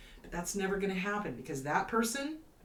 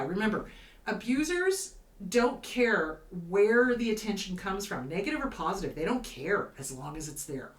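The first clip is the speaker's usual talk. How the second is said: ordinary talk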